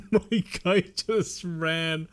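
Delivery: laughing while talking; giggling through words. Real, not acted.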